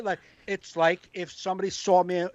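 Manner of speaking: ordinary talk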